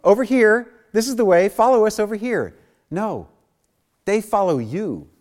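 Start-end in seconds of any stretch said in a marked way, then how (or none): 0.0-2.5 high, loud sing-out to followers; mock tour-leader call, high and loud; calling out yoo-hoo. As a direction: holler as if waving people over from afar. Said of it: "aren't out there saying".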